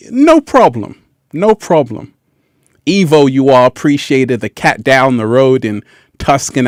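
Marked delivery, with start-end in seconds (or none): 0.1-2.1 bright carefree no problem. Acted. Carefree dismissal.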